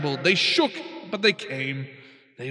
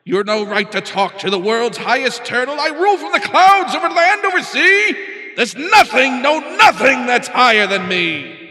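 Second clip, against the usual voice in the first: much higher, louder royal rant; ranting king; 9 semitones higher, 11 dB louder than usual. Acted. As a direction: roar it from the clouds. King shouts down at Mac.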